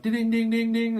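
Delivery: high, loud string of dings; insistent dings held on one high pitch; imitated bell. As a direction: mimic a bell rung for servants.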